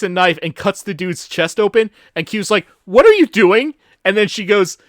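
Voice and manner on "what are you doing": mock alarm. Acting out Q's shocked question.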